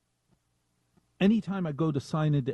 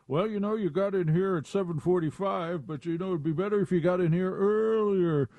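higher, mocking nag from a smug boss; jerk boss voice; 5 semitones higher than usual. The jerk boss scolding an employee for arriving late, mocking. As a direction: sneer it like the jerk at work. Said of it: "the jerk who says".

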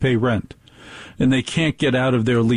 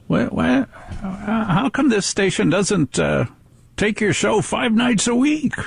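mock caller; 8 semitones higher, slower than usual. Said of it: "and said".

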